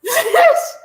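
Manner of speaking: high, loud whoop through laughter; delighted laughing yell; laughing shriek. Real, not acted.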